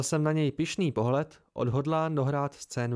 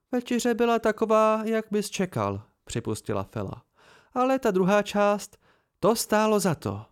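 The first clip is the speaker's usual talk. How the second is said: higher female voice, gleeful; Fela's voice; 7 semitones higher than usual. Acted. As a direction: savour the second part. Fela voiced, pleased.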